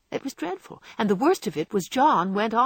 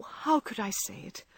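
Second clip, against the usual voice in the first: softer, broken repeat; tearful murmur; 3 semitones higher, 6 dB quieter than usual. A narrator's reading. Meg repeating her remorse to John, quieter and broken. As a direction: let it sink into a tearful murmur. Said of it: "crying with repentant tears".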